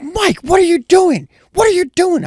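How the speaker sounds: imitating his boss scolding him; angry boss. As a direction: mimic an annoyed boss nagging. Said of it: "So Jen's like".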